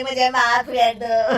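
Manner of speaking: ordinary delivery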